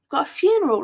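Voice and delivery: surprised. Retold or imitated.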